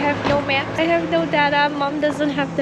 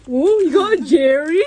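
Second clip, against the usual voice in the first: surprised; 4 semitones higher, 7 dB louder than usual. Real, not acted. Gasps at blind box prize.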